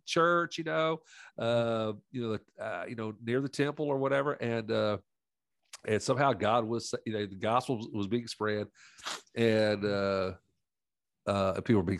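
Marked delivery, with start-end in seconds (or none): none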